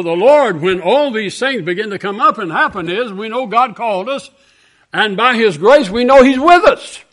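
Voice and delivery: loud, high pulpit preaching; full-force revival sermon; fervent preaching. Acted. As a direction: drive it home to the brethren.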